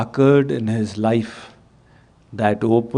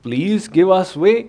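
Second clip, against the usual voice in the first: raised pitch, louder plea; 6 semitones higher than usual. Retold or imitated. Disciple's guru insisting the woman clear the road, louder. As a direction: say it again, pushing louder. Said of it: "said in a louder voice".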